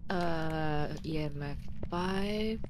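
low, soft murmur to oneself; hushed murmur. Real, not acted. Player mid ghost hunt, murmuring to himself. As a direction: think aloud softly and low, with long gaps.

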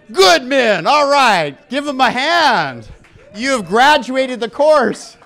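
loud, high and slow, like a hype man; booming ceremonial praise; rousing congratulations. Real, not acted. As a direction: celebrate the crowd at full volume. Congratulating audience after the pledge.

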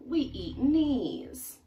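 high, slow, weighty declaration; deliberately menacing; slow gruff piranha. Acted. Piranhas declare their food.

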